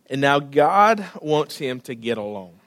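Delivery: ordinary delivery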